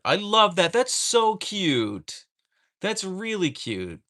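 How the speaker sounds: high, loud, drawn-out squeal of delight; delighted gushing. Real, not acted. Streamer hearing about a viewer's little son, delighted gushing. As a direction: gush over something adorable.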